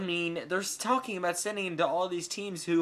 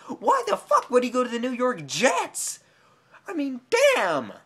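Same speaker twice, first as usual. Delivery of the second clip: loud, raised outrage; angry rant; 6 semitones higher, 6 dB louder than usual. Real, not acted. Fan ranting about the Jets trade, loud and angry. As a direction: vent at full volume.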